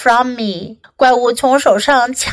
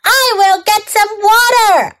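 high-pitched answering call; yelling back; 8 semitones higher than usual. A narrator's reading. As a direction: call back high without full volume. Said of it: "I yelled back".

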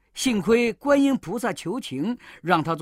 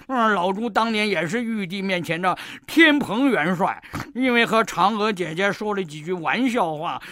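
louder, aggrieved rant; angry; 4 dB louder than usual. Acted. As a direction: complain hotly and loud. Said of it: "那妖怪气得".

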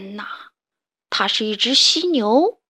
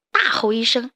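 ordinary delivery, nothing marked

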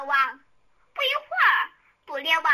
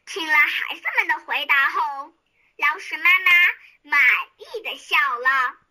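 ordinary delivery, nothing marked

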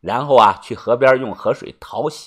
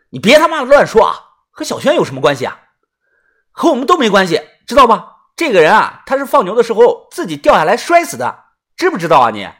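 raised-pitch, emphatic telling-off; scolding; 5 semitones higher, faster than usual. Acted. Scolding the sidekick for loose talk, sharp and insistent. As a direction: drum it into him, irritated and insistent.

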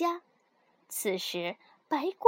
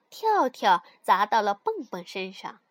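plain reading voice

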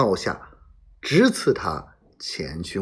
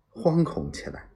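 plain reading voice

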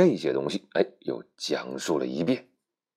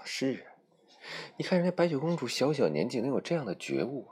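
inner thought; 8 semitones higher than usual. Acted. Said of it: "心里想".

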